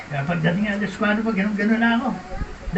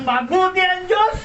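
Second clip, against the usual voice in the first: sincere declaration; 7 semitones higher, slower than usual. Retold or imitated. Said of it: "nasabi niyang".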